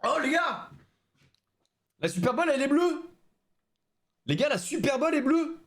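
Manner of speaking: aigu et excité; s'exclamer devant une découverte; surpris. Real, not acted. Découvre que l'intérieur est bleu. (émerveillé et aigu)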